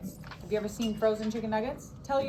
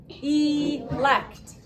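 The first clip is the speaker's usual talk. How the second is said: Elect said louder, with each syllable beaten out; pounded syllables; 3 semitones higher, 4 dB louder than usual. Shown as a demonstration. Teacher leading class in pounding syllables. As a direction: beat out e and lect. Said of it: "Pound it".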